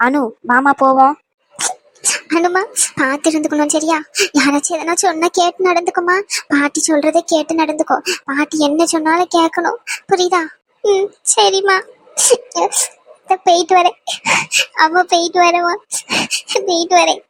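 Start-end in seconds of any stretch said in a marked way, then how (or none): 2.4-10.4 choked-up, gently urging; tearful advice. Acted. Mother advising the bride to obey grandmother, tearful. 10.8-17.2 higher voice, halting between sobs; tearful goodbye. Acted. Bride taking leave of her mother, crying.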